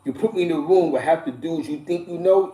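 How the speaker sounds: ordinary talk, nothing marked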